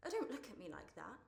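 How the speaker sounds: usual speaking voice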